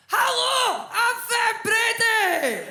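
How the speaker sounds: very high, loud, slow mock Scottish shriek; bad Scottish accent. English friend mimicking her Scottish pal, bad shrieked Scottish accent. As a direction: screech a terrible Scottish accent. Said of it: "in a bad Scottish accent".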